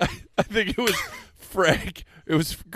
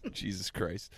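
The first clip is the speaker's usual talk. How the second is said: ordinary talk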